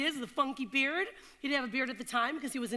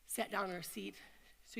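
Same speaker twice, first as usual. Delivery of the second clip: ordinary talk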